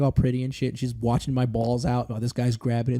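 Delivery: normal talking voice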